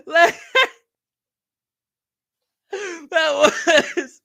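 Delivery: high laughing, almost crying; helpless laughter. Real, not acted. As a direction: laugh until you nearly cry. Laughing helplessly at own choke.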